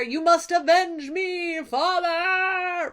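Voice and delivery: high, slow, wailing plea to father; pleading prayer. Retold or imitated. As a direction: cry out slowly to the sea god for vengeance. Son prays to Poseidon.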